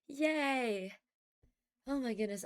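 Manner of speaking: normal talking voice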